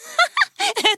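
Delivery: high amused giggle; a bright little laugh; girlish laugh. Acted. Zélie laughs at Timmy.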